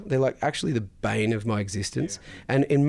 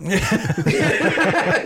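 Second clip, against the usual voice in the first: real laugh; 9 semitones higher, 5 dB louder than usual. Real, not acted. Laughs at own retort.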